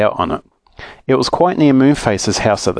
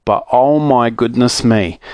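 usual speaking voice

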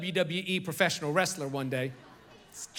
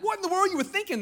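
high, incredulous parent scolding; mock-scold a kid who did something stupid; hammed-up disbelieving parent; exasperated parent; 12 semitones higher, 4 dB louder, faster than usual. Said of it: "You're like".

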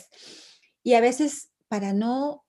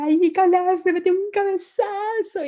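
put-on high, loud tattling voice of a four-year-old; whiny little girl; 10 semitones higher, 6 dB louder than usual. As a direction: whine it like a little kid complaining. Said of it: "ella baja sus cuatro años". (hija quejándose como niña de cuatro años)

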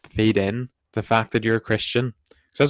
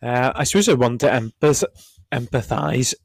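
hoarse, croaky voice; 3 semitones higher, 4 dB louder than usual. Real, not acted. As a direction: talk through a croaky throat. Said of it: "We both have croaky voices today".

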